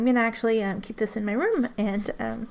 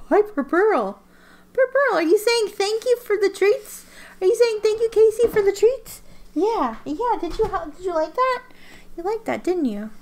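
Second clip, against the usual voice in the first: pet talk; 10 semitones higher, 6 dB louder than usual. Real, not acted. Owner cooing high and loud at her cat Pearl.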